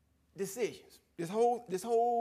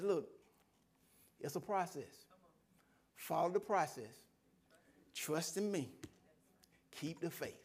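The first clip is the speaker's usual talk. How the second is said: softer, measured, steady reassurance; calm voice of God; 6 dB quieter than usual. Retold or imitated. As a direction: answer a rant patiently, with pauses. God calmly answering his rant.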